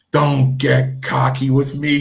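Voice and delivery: drawn-out acted rebuke, loud; mock scolding. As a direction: imitate a boss putting someone in his place. Chief's rebuke acted out in story.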